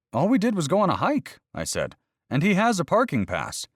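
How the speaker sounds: high, exasperated protest; indignant protest. Acted. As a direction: plead your innocence, voice jumping up. Narrator protests the citation.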